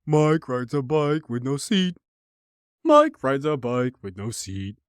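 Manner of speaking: slow, sing-song schoolyard taunt; mock the bullies chanting it. Imitating schoolmates taunting him, slow sing-song mockery. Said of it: "these fuckers repeat it constantly".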